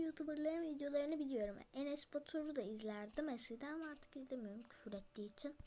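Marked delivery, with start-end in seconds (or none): 1.7-5.6 hushed, gossipy whisper; a whispered aside to the viewers; secret whisper. Real, not acted. Gossiping aside about another YouTuber.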